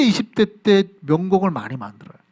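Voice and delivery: ordinary talk, nothing marked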